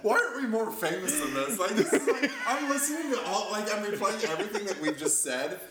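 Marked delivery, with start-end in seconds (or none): 0.0-4.6 high-pitched, talking through laughter; laughing. Real, not acted. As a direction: protest while cracking up. Laughs, mocks own wish for fame.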